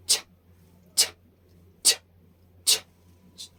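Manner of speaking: "छ" as bare voiceless puffs; air-release demo. Shown as a demonstration. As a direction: push air out sharply on each "छ". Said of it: "अंदर से air को". ("छ" सिर्फ़ हवा छोड़ते हुए, बिना आवाज़)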